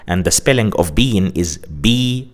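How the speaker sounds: ordinary talk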